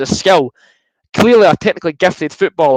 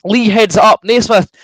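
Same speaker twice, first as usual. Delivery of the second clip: fast-building match commentary, higher and louder; live play-by-play; 6 semitones higher, 4 dB louder than usual. Acted. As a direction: call the replay like a TV commentator.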